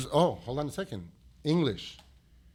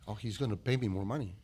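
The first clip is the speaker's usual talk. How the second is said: ordinary talk, nothing marked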